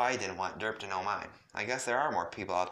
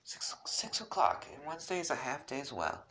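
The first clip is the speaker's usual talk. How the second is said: whispered, higher and soft; 7 semitones higher than usual. Acted. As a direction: whisper it shyly as Rose. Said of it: "Rose informed me".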